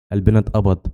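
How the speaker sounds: usual speaking voice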